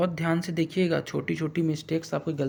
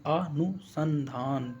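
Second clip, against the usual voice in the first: अनुसंधान very slow, "a... nu... san... dhaan"; sounding it out; 4 dB quieter, much slower than usual. Shown as a demonstration. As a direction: spell out अनुसंधान by syllables. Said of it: "ये वाला हो जाएगा".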